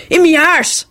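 high-pitched, resentful retort. A narrator's reading. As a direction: throw it back resentfully. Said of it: "the man replied resentfully".

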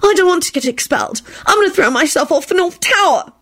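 high, panicked whine; desperate wail. A narrator's reading. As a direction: wail in despair. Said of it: "Esther wailed desperately".

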